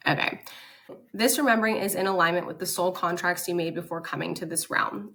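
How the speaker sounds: ordinary talk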